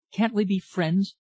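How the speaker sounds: high-pitched, mildly eager appeal. A narrator's reading. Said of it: "she said eagerly, her voice shaking".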